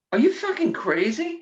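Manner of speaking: high-pitched retold disbelief; incredulous. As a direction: act out the manager's incredulous question. Manager's incredulous reaction, retold.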